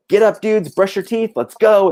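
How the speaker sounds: high, bright rousing call; wake-up call. Retold or imitated. Waking the bandmates on tour, loud and cheery. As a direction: rouse the sleepy band like a camp counselor. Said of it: "I'm like".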